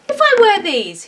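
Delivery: a lightly voiced bear announcing. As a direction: proclaim it like a proud little bear. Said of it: "he announced".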